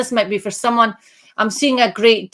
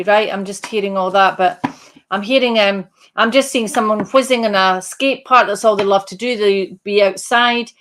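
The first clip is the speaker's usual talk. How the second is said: ordinary delivery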